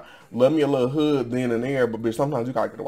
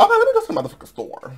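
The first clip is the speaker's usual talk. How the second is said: much higher and very fast; hyped self-quote; 3 dB louder, much faster than usual. Retold or imitated. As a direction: rattle it off high and quick, pumped up. Quoting own decision to go.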